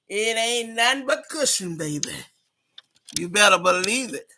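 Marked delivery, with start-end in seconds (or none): none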